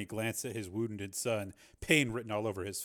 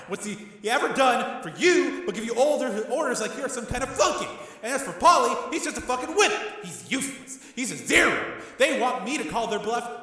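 loud, much higher raging tirade; enraged tirade; 11 semitones higher, 8 dB louder than usual. A narrator's reading. Tommy raging at his brother, loud tirade. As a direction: rage at everyone.

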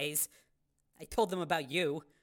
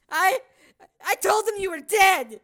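guilty; 10 semitones higher, 8 dB louder than usual. Acted. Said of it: "looking away guiltily".

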